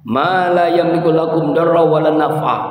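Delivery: high, loud chanted Arabic; Quranic recitation. Acted. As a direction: recite the verse in full voice. Quran recitation within sermon. (tilawah ayat dengan lantang)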